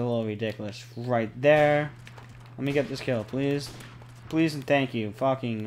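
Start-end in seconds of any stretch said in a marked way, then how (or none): none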